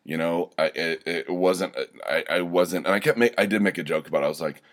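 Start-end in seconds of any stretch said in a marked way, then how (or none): none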